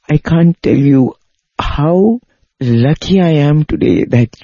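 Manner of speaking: retelling his words high and moved, close to tears; tearful, overwhelmed. Retold or imitated. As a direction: voice lifted by emotion, a mild imitation of someone weeping with gratitude. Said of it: "he just started crying. He said".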